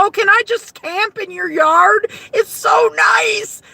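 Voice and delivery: asking her sister-in-law to camp in the yard, high and pleading; mock pleading. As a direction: plead like a kid asking a favour.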